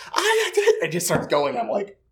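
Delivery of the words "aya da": put-on very high child's chant; imitated kid's squeal; four-year-old. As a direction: burst out like a chanting little kid. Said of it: "and goes".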